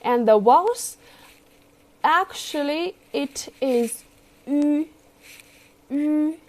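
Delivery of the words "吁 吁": 吁 with rounded front ü; 吁 said with ü not u; rounded ü vowel. Shown as a demonstration. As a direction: round the lips for 吁. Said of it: "don't think this is 乌, no, it's 吁".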